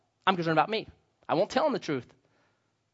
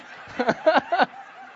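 burst of laughter; 9 semitones higher than usual. Real, not acted. Laughing at own story.

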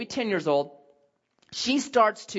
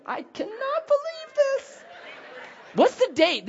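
amazed; slower than usual. Real, not acted. Surprised by something.

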